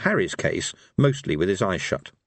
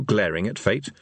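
plain reading voice

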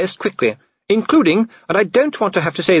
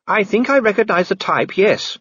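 high, knowing wifely remark; high-pitched pointed understatement; ironic; 11 semitones higher than usual. A narrator's reading. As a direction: agree with veiled irony. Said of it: "with an irony that failed to register".

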